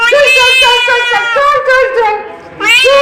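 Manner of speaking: a high, loud, repeated call to coax the pet along. Real, not acted. Coaxing the pet.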